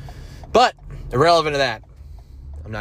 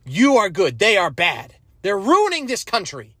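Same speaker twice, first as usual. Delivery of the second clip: mock campaign rhetoric; 8 semitones higher than usual. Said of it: "is, like, be like".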